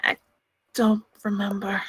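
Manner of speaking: low, soft, halting denial; evasive. Acted. Witness dodging the question about a cover-up, quiet and evasive.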